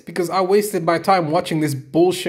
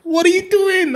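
high, loud exasperated yell; yelling at the screen; 4 dB louder than usual. Retold or imitated. Fan yelling at a passive fighter on screen, high loud exasperation. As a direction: shout your disbelief at the TV. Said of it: "I'm screaming at the screen".

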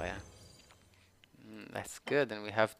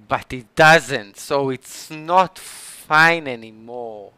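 louder, slow and stressed; slow loud complaint; 12 dB louder, slower than usual. Real, not acted. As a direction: hammer each word out. Emphatic complaint.

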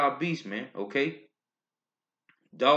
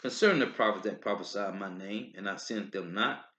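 low, measured Bible reading; solemn scripture reading, lower and softer than his talk; 5 semitones lower, 4 dB quieter than usual. Acted. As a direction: read the verses solemnly and deliberately. Reads scripture aloud.

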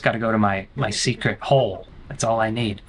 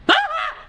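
short, very high-pitched shriek; startled scream. Real, not acted. Player searching Grandma's house gets jumped, high scream. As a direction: shriek in sudden fright. Jump scare.

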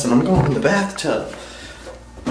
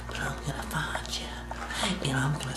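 menacing whisper; 11 dB quieter than usual. Acted. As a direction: promise it quietly and coldly.

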